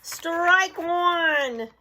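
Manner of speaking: high, loud strike call; umpire's call. Acted. Umpire calls the first pitch, loud call. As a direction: call the strike for the whole park.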